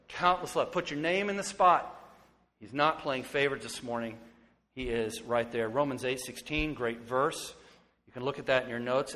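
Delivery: usual speaking voice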